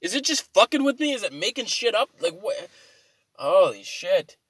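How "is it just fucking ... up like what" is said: higher, indignant questions; baffled outrage. Retold or imitated. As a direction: rant at the phone in disbelief. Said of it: "I'm like".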